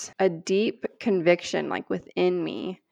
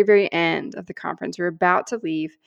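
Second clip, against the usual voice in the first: ordinary talk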